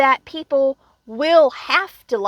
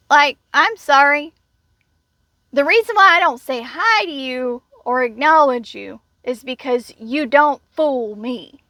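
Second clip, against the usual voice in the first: normal talking voice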